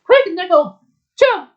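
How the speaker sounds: high, urgent command; calling out. Acted. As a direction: urge a friend to jump to safety. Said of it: "I called out to her".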